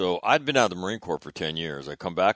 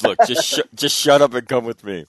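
loud, raised-pitch mock exasperation; exasperated order; 6 semitones higher, 10 dB louder than usual. General to reluctant staff officer, loudly exasperated. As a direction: cut off the objections loudly, imitating a superior. General cuts short objections, retold.

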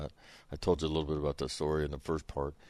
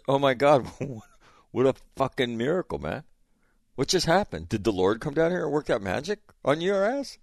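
high, loud sarcastic cheer with a laugh; mock amazement at a small miracle; dad sarcastically praising his son for finally giving gas; sarcastic praise; 8 semitones higher, 4 dB louder than usual. Mocking son's belated success.

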